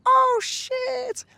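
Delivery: very high, surprised squeal; shocked. Retold or imitated. Vlogger reliving his shock at the coincidence, high surprised. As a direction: yelp it in disbelief.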